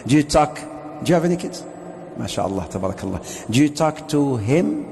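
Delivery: ordinary talk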